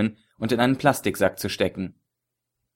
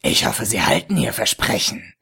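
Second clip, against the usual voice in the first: grinning villain. A narrator's reading. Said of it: "grinste der Ägypter".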